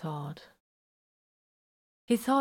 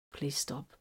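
much softer, barely holding together; strained plea; 3 semitones lower, 11 dB quieter than usual. A narrator's reading. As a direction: force the words out as he fights to keep composure. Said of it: "Vox managed".

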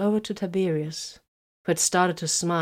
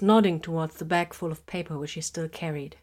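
ordinary delivery, nothing marked

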